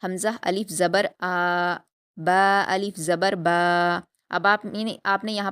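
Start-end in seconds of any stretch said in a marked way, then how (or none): 0.0-1.8 حمزہ الف زبر آ read slowly with آ long; stretched madd. Shown as a demonstration. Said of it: "کتنا کھینچنا ہے". 2.2-4.0 با الف زبر با with the final با long and slow; stretched madd. Shown as a demonstration. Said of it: "کتنا کھینچنا ہے".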